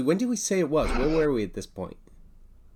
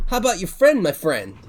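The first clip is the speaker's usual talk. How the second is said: high, loud, cheery innkeeper; eager bartender; 6 semitones higher, 6 dB louder than usual. Acted. Bartender turning to the other guest, high and bright. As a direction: bounce up brightly on the question.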